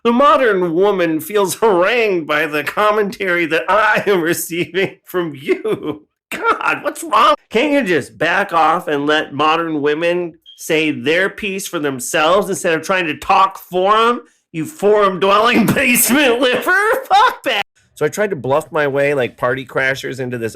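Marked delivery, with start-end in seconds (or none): 0.0-6.1 mock indignant. Mock offended rant at commenters. 6.3-17.6 rising into a shrill mock outburst, louder and higher; hammed-up indignant yelling at a forum troll; a huffy rant that climbs to a shout; building mock rant. Escalating mock rant at forum user.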